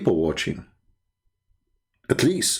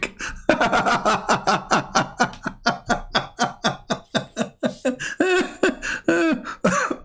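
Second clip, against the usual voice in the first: long, high fit of laughter; real laughing fit; 8 semitones higher than usual. Real, not acted. Host cracking up at the absurd cover-up, long high laugh. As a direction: laugh helplessly, on and on.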